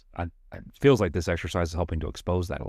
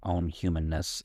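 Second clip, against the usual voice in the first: usual speaking voice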